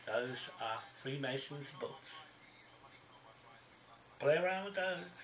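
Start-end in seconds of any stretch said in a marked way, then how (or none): 4.2-5.1 high, loud caution; sharp high warning, much louder; raised warning. Real, not acted. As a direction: lift the voice on the danger. Warning about lethal books.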